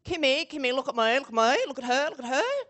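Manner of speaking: loud, eager whispered-stage gossip; mock gossipy fashion-show spectator; gossiping front-row lady. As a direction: nudge your friend and point, loudly. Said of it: "And it goes like".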